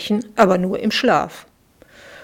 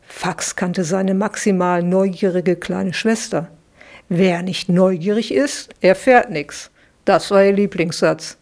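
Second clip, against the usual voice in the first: plain reading voice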